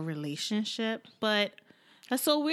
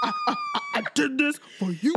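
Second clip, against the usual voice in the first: stammering mock rage; 5 semitones higher, faster than usual. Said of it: "He's like".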